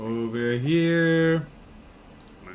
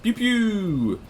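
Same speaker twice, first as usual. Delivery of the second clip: high playful pew sounds; mouth laser pew; 7 semitones higher than usual. Acted. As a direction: zap it out like a toy gun. Gun sound effect.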